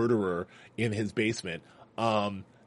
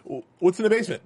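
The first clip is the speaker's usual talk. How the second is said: quick, higher prying voice; suspicious question; 8 semitones higher, 3 dB louder, faster than usual. Retold or imitated. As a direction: press him with a question. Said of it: "this guy is like".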